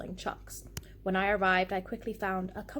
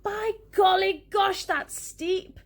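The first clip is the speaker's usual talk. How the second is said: high, loud burst of outrage; shocked cry; 11 semitones higher, 6 dB louder, slower than usual. A narrator's reading. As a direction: exclaim like an appalled mother. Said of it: "She cried".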